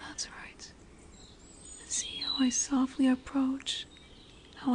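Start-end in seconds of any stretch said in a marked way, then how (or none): 0.1-0.7 very soft, voiceless whisper; hushed approval, barely audible; luring whisper. Acted. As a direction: whisper it as gentle coaxing. Lamia luring the human closer.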